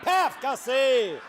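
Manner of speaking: mock knockout blow. Comedian celebrates a landed jab.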